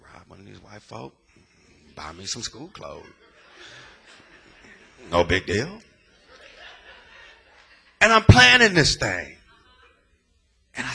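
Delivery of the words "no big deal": ordinary talk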